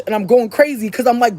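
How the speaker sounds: ordinary talk, nothing marked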